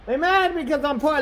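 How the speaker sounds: ordinary delivery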